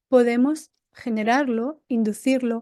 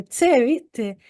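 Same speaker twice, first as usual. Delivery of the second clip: Argentine accent; slower than usual. Shown as a demonstration. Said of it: "de vez en cuando se lo suelto".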